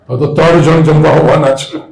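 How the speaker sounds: loud exaggerated alarm on ત્રણ; mock panic. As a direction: ham up the worry about ત્રણ guests. Mocking mother's panic. (ત્રણ પર ભાર મૂકી નાટકીય ચિંતા)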